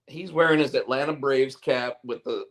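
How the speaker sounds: usual speaking voice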